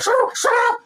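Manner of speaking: very high, loud squawk; hammed-up petulant outburst; stroppy teenager. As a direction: shriek it like a teen in a strop. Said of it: "just a stroppy teenager".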